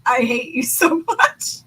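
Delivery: slow, laughing through the fake grudge; laughing mock hate. Retelling Elend's reply to Kelsier, slow and laughing. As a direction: stretch it out, cracking up mid-line. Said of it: "Kelsier insults him and he's like".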